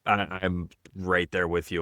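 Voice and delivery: normal talking voice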